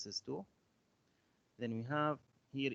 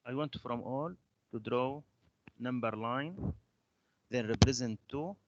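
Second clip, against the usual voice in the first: louder, projected teacher instructions; raised voice; 8 dB louder than usual. Real, not acted. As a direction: speak up so the class can hear. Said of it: "your voice is so low".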